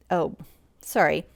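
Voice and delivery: usual speaking voice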